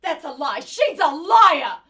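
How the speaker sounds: loud, slow, rising cry of liar; furious shout. Acted. Mother yelling at the housekeeper in court, furious. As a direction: shout her down.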